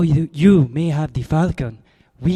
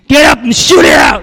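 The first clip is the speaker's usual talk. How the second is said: shouted challenge, much louder and higher; furious shout; 9 semitones higher, 10 dB louder than usual. Acted. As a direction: yell it, high and loud, spoiling for a fight. Gunman snaps, challenges Spade.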